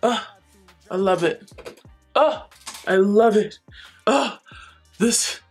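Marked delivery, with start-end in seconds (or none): none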